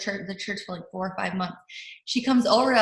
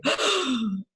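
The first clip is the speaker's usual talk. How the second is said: sharp acted gasp of amazement; big audible intake of breath; shocked gasp; 3 semitones higher, 3 dB quieter than usual. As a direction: suck in air, astonished. Acting out reaction to the message.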